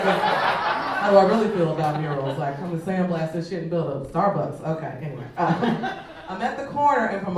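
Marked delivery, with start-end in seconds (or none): none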